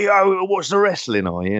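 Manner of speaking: a light put-on London geezer accent; mock a rough London barman's accent; imitating barman's accent. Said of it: "I don't know why he was talking like that".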